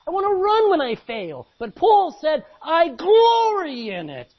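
high, shouted pulpit climax; loud exultant holler; full-force preaching. Real, not acted. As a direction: cry it out to the whole church.

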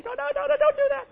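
mock squeal, very high; high protest. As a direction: squeak out the refusal in alarm. Voicing people refusing foot washing.

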